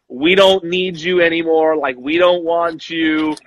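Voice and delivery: ordinary talk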